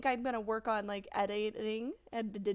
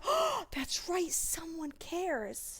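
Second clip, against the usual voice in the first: sarcastic slow drawl; 5 semitones higher, much slower than usual. Retold or imitated. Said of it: "And I'm like".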